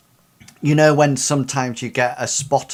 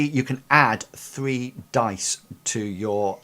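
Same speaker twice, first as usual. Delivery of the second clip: ordinary delivery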